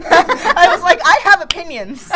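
laughing while quoting herself. Retold or imitated. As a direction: giggle through it. Laughing about killing the question.

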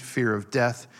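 ordinary talk, nothing marked

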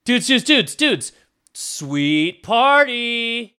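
high, stretched dude voice; a party-happy surfer sprite drawling dudes; fairy greets the party in surfer talk, high and stretched; excited stoner fairy. Acted. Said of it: "and she's like".

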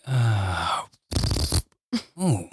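long, slow sigh of mock relief; drawn-out words, acting out blissful release; relaxed relief. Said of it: "just relaxes and is just like".

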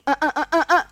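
"ah, ah, ah" cortando os outros; cortando a fala. Retold or imitated. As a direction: imita ela interrompendo, sem deixar continuar. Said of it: "Sabe aquele jeito dela". (Yasmin cortando o papo da colega, interrompendo)

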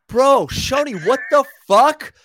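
high, loud, disbelieving yell; shocked outburst. Retold or imitated. Promoter buddy yelling at Shoney after the tequila spray, loud disbelief. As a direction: blurt it out in total shock at your friend.

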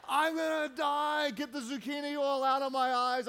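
high, hammed-up wail of distress; retold panicked pleading; mock panic. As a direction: cry out in comic agony. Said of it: "I'm screaming out".

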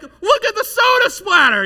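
high, loud mock yell; hammed-up outrage at the splatter; mock outraged shout. Wants everyone ruined; doesn't yell.

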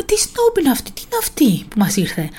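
mock-indignant coworker, pitch leaping high at first; Άννα imitated, disapproving. Coworker recalling first impression of the new girl, mock indignation. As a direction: imitate a put-out colleague sizing up a newcomer. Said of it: "που μου το έλεγε κιόλας".

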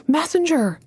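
high-pitched call, falling at the end; excited cry. A narrator's reading. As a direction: cry out with a rush of hope. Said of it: "she cried".

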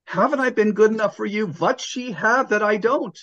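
put-on high-pitched wail of a wounded old woman in a joke; crying out. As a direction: plead in a hammed-up, high voice, hurt and betrayed. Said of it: "but Becky cries out".

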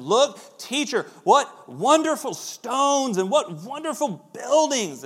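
star-struck follower pointing at the temple; awed disciples. Retold or imitated. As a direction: gush over the stones.

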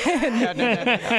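laughing protest; giggling no no no. Real, not acted. Embarrassed refusal, laughing.

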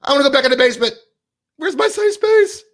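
hammed-up high whimper; mocking whiny beta male. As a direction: sneer it like a scared man wanting his safe space. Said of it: "You're like".

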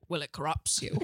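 put-on deadpan British accent, lightly done; lightly voiced British woman; Michael Caine as a woman. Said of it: "It was like Michael Caine, but a woman".

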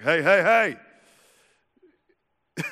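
loud, rising calls to halt; mock shout to cut someone off; argument stopper. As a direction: break into a heated argument. Acting out halting an argument.

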